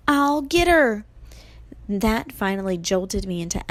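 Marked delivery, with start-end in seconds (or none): 0.1-1.0 high, loud battle call; eager yell. A narrator's reading. Grover charges at Medusa, yelling high. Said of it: "he yelled again".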